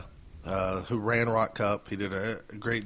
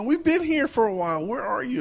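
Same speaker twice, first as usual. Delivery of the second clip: much higher, louder teasing yell; high shout; 5 dB louder than usual. Retold or imitated. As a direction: rib him by shouting high. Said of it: "I go".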